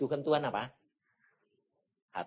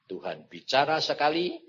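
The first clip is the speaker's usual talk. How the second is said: ordinary talk, nothing marked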